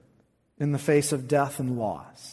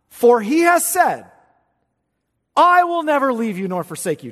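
loud, higher, ringing pulpit proclamation; preaching at full force; 7 semitones higher, 6 dB louder than usual. Acted. Preacher proclaiming Hebrews to his congregation, full force. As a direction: thunder the promise from the pulpit.

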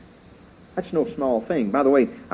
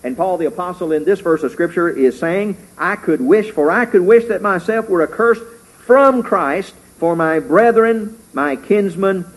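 full-force preaching; 5 semitones higher, 7 dB louder than usual. Acted. Preacher crying out Paul's wish to the congregation, fervent. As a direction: preach it out with rising fervor.

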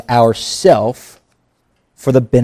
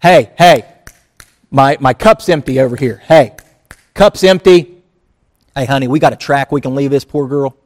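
rude customer; 4 dB louder than usual. Pretend. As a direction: bark for a refill like an entitled diner. Acting rude restaurant customer.

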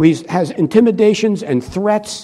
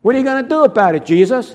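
taunting; 5 semitones higher, faster than usual. Retold or imitated. Soldiers taunting Jesus.

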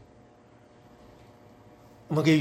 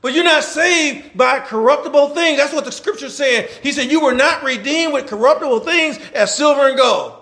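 higher, louder, fast preaching with bursts of shouting; preaching at full force; 6 semitones higher, 5 dB louder than usual. Acted. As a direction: fire up the congregation, pushing hard.

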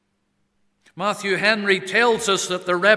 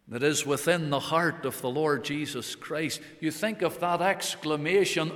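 ordinary talk, nothing marked

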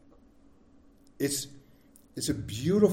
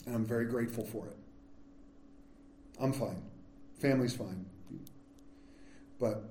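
softer, choked-up words; holding back tears; 7 semitones lower, 12 dB quieter than usual. Real, not acted. Pastor thanking the church for support, close to tears. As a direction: fight to keep your voice steady. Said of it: "I'm gonna start crying".

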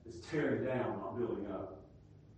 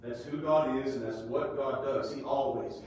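normal talking voice

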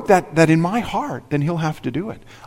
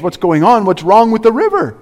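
mock amazement; 6 semitones higher than usual. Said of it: "must have been amazed".

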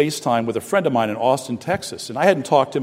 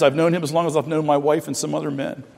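usual speaking voice

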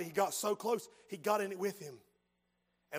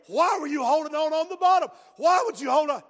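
louder urgent plea; anguished father; 5 semitones higher, 9 dB louder than usual. Acted. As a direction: shaken father demanding why. Father pleads with rescued son.

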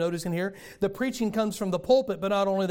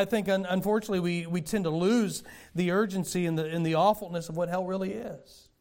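normal talking voice

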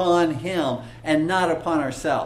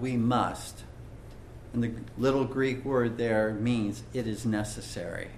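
ordinary talk

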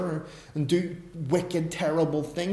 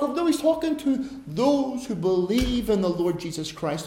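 ordinary talk

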